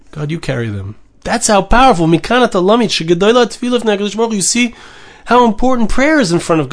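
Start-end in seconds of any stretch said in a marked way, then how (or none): 0.1-0.9 quiet. Acted. Prayer voiced, then softly echoed.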